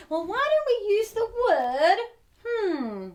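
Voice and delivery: usual speaking voice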